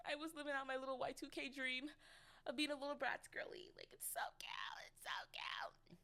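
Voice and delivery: put-on high, whispery girly squeal; gushing squeal. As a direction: gush in a tiny high voice, breath taking over. Gushing over her Y2K look.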